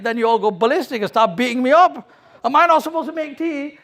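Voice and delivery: put-on high, hurt child's complaint; a wronged boy protesting through tears; upset crying kid. Beaten boy pleads with the adults, upset and high. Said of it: "the kid, he starts crying".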